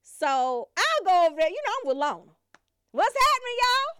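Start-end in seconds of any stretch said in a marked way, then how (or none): 2.9-3.9 cheery greeting. Retold or imitated. Greeting the family on arrival.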